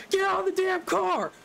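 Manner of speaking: high, shouted mock scream. The cop screams at the lady to get out, mock yell. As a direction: scream it in her face. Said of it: "screams in her face".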